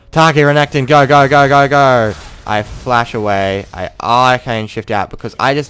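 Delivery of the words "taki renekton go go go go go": urgent yell. Retold or imitated. Retold in-game call to teammates.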